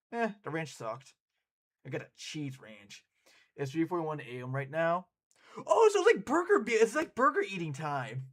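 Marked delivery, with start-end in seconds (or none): 5.7-8.3 excited. Real, not acted. Excited realisation of late-night food.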